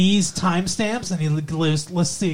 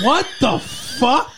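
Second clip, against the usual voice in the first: slow, drawn-out, higher what the fuck; stunned disbelief; 6 semitones higher, much slower than usual. Real, not acted. Hearing the puppies-in-a-bag comparison, slow stunned disbelief. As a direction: let it hang in shocked disbelief.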